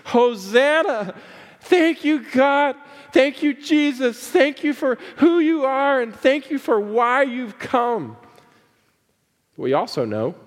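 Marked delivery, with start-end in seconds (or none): 0.1-1.1 much higher and louder, stretched slowly; joyful hosanna cry. Retold or imitated. Preacher voicing the Palm Sunday crowd, ringing joyful cry. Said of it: "And they're lifting their voices". 1.6-8.2 much higher, louder thanks, lifted to God; exuberant praise. Retold or imitated. Crowd praising Jesus as he enters, exultant high praise. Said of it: "And they're lifting their voices".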